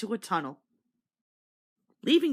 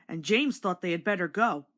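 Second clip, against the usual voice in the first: ordinary delivery, nothing marked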